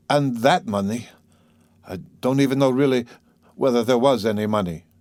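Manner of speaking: ordinary delivery, nothing marked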